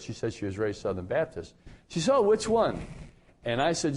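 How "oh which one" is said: high-pitched retold question; eager imitation. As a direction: imitate her keen curiosity, pitched well up. Retold eager question about his church.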